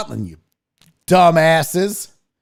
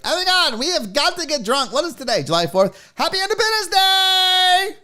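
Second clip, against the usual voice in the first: high, gushing mock excitement; basic party girl; 10 semitones higher than usual. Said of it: "some basic bitch in Philadelphia that was like".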